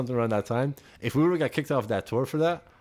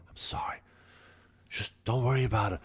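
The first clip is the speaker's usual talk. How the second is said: near-whispered, furtive reassurance; hushed apology; 6 dB quieter than usual. Retold or imitated. Tour manager apologizing discreetly to the snubbed singer, hushed. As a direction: mouth a quiet apology behind someone's back.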